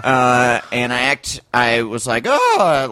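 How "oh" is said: high mock gasp of fright; acted scared. Guest re-enacts pretending fear of the old man, mock-scared. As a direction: fake a frightened "oh", pitched up. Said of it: "like, I was scared".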